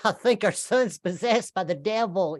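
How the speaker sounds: high, fretful Southern mother; hammed-up fretting Southern mom; worried Kentucky mom. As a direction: fret to the Lord about your son. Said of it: "She was like".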